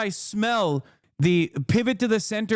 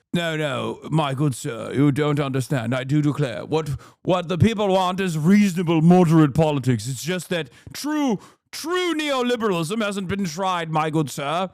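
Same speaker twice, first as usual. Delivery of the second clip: put-on posh British accent. Moderate explaining to his good sir, mock posh British. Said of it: "posh British accent".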